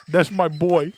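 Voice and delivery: Italian dad. Pretend. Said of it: "is this how Italian men".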